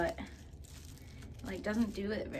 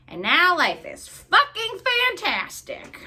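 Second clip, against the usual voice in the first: louder and higher, slowed; excited exclamation; 10 semitones higher, 11 dB louder, slower than usual. Real, not acted. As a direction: proclaim it with joy. Emphatic loud exclamation.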